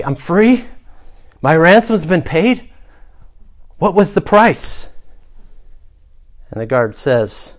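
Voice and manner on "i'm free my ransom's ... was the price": higher, astonished rising questions; gasping disbelief. Acted. Freed prisoner questions the guard, gasping in disbelief. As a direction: ask it as if you can't believe it. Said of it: "you gasp, and you say".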